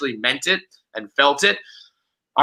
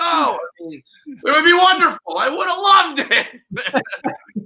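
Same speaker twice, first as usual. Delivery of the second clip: high, giddy, laughing gush; 12 semitones higher, slower than usual. Pretend. Dreaming of being a Yankee fan, giddy laughing gush. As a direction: swoon over the dream of fandom. Gushing over imagined fandom.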